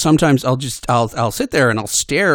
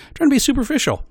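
high, chirpy fake cheer, louder; mock chirpy; 3 dB louder, much faster than usual. Real, not acted. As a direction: play it falsely bright. Ironic self-comment.

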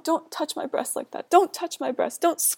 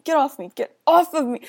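louder desperate shout; 3 semitones higher, 8 dB louder than usual. Acted. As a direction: yell it, pushing him off. Shoving attacker away.

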